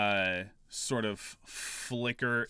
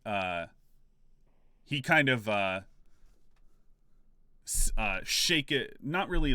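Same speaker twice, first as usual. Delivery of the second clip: usual speaking voice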